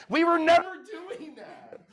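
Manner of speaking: loud, laughing, high; laughing shout. Real, not acted. Laughing at his own naivety.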